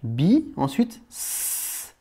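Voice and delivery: bi, then a hissed s, said slowly; slow split of bi and s; syllables split slowly. Shown as a demonstration. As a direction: spell out bismi sound by sound. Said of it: "Tu entends".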